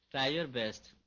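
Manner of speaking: ordinary talk, nothing marked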